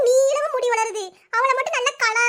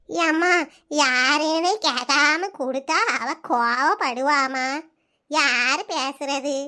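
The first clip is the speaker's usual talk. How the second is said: put-on slow, lower, puzzled old granny on the phone; old grandmother; 8 semitones lower, much slower than usual. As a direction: drag each word like a confused grandma.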